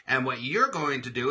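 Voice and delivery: ordinary talk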